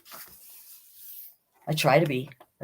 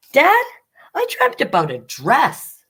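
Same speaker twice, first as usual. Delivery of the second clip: put-on much higher, louder kid calling Dad; eager child; 10 semitones higher, 4 dB louder than usual. Bailey finding Father in the yard, higher eager child. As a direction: call out brightly like a child.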